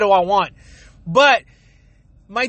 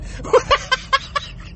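silent wheezing laughter, very high; cracking up without voice; high airless laugh; 13 semitones higher than usual. Real, not acted. Laughing fit over the excuse.

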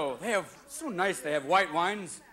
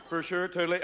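Californese. Acted. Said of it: "eighteen words in Californese".

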